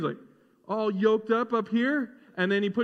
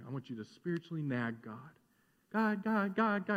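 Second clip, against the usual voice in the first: mock nagging; 5 semitones lower, 8 dB quieter than usual. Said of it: "this spiritual nagging".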